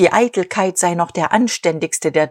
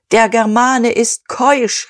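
higher, louder, slow; proclaiming; 6 semitones higher, 4 dB louder, slower than usual. A narrator's reading. Dieterich lectures the assembly, slow loud proclamation. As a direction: proclaim it slowly to the hall. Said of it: "rief Dieterich".

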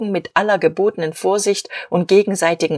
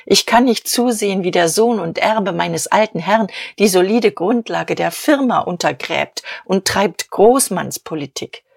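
ordinary delivery, nothing marked

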